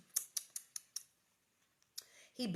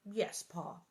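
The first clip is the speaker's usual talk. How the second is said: softer, sober agreement; sober promise; 6 dB quieter than usual. A narrator's reading. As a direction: promise it gravely and quietly. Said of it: "Laura promised soberly".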